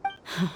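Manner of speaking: a quiet, relieved sigh; soft sigh. Acted. Dia sighing softly as the travelers agree to join. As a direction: let out a soft breath of relief.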